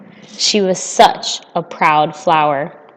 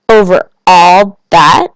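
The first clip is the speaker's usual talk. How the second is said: slow, louder Over all that? rising; wondering question; 6 dB louder, slower than usual. A narrator's reading. Little prince to king, slow awed question. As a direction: draw out the question in awe.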